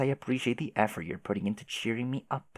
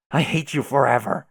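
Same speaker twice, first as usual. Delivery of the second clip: loud yell; 2 semitones higher, 7 dB louder than usual. Acted. Repeated declaration of hatred.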